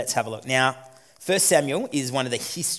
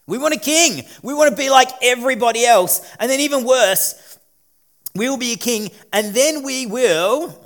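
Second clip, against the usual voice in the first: higher, louder whiny demand; mock demanding crowd; 7 semitones higher, 7 dB louder than usual. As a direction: act out the people begging for a king. People demand a king, acted.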